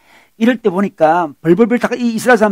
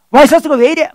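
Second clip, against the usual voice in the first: higher-pitched testy retort; mock agitation; 6 semitones higher, 3 dB louder than usual. Husband snaps back at his concerned wife, mock agitated. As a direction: imitate a husband getting more worked up. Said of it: "더 흥분하고 있잖아요".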